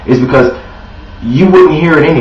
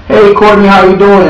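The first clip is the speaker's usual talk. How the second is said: higher, louder bright hello to a client; cheery phone greeting; 6 semitones higher, 4 dB louder than usual. Pretend. Salesman warmly greets client Courtney on the phone, upbeat and louder. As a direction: greet her like an old friend, upbeat.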